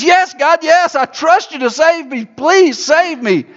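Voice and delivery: high, urgent plea, slowing on the last words; a man in a well pleading with God. Acted. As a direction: beg to be saved.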